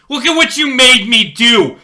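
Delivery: high, loud raging yell; angry yell. Acted. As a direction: blame him at full volume. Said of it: "Cop seventy-eight yells".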